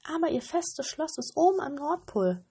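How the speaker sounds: high, brighter bird voice telling the way; pigeon voice. A narrator's reading. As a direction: chirp the directions like a dove. (hohe Taubenstimme)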